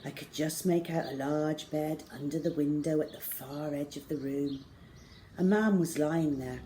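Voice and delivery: ordinary delivery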